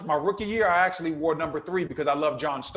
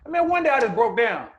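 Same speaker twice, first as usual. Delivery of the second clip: ordinary talk, nothing marked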